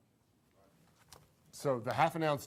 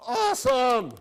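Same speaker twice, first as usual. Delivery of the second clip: excited; 12 semitones higher, 12 dB louder, slower than usual. Real, not acted. Teacher praises the class total.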